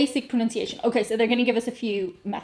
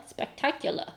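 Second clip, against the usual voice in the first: soft New York "Spectacular", ending on "la"; quiet attempt at a New York accent; 2 semitones higher, 9 dB quieter than usual. Shown as a demonstration. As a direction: try "Spectacular" as a New Yorker, final r dropped. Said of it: "Maybe New York on the la".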